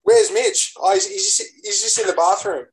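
high-pitched mock concern; exaggerated high voice, easing down; playful party chatter. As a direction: act out friends wondering where he went. Acts out friends at the party.

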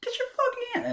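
very high, loud imitation of a panicked wife; frantic shriek. Wife fending off her husband, mock frantic screaming.

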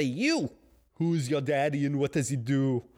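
lightly voiced tough action-movie cop; Kindergarten Cop impression. Talking to himself in the mirror, a light action-hero impression. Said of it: "Kindergarten cop, everybody".

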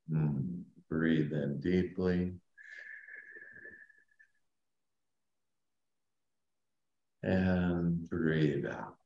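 slow, soft meditation voice; sleep story narrator. Acted. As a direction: guide them down gently, fading to a whisper. Leads a guided meditation.

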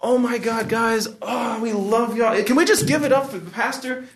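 very high, gushing emcee imitation; mock over-animated host, words stretched; church emcee. Emcee asking applause for the pastor, hammed-up gushing. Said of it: "M C comes up".

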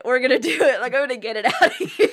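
hammed-up pep talk. She urges her boyfriend to break down, hammed-up pep talk. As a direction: cheer him on like a coach.

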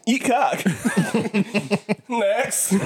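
laughing, high mocking retort; sarcastically calling out a troll. Real, not acted. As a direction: brush off the hater with a laugh.

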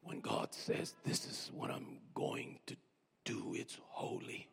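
hushed whisper; whispered preaching. Real, not acted. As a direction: whisper it like a secret. Whispered hush.

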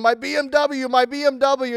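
high mock scream over a wrecked car; screaming man. Car owner screams to a police officer, high mock scream. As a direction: wail it like a man losing his mind. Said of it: "the guy screaming".

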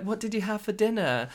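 high, loud, put-on friendly question; over-eager small talk. Shop worker breaking the silence with a star, over-eager. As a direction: imitate an awkward attempt at chat.

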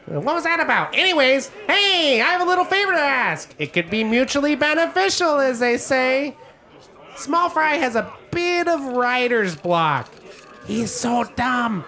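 high, chirpy innkeeper gnome; Gilly the gnome. Acted. Gnome innkeeper offering ales, high and chirpy.

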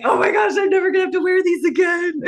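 high, gleeful shout; excited. Retold or imitated. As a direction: squeal it with joy. Gleefully ditching winter coats.

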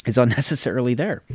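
ordinary talk, nothing marked

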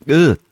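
loud, high "ugh"; short groan of revulsion; disgusted groan. Real, not acted. Disgusted at men kissing.

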